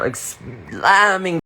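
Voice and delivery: ordinary talk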